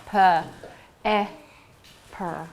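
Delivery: "P, E, per" as separate sounds, puh, eh, per; sounded out. Shown as a demonstration. Teacher taking a word apart for pupils, "P, E, per" sounded out. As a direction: leave gaps between puh, eh and per. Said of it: "Let's take it apart".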